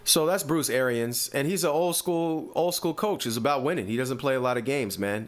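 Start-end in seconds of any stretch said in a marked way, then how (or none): none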